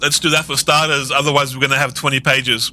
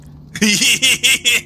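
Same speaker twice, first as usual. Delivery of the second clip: high giggling burst; high laugh; 11 semitones higher than usual. Real, not acted. Laughs after alien voice.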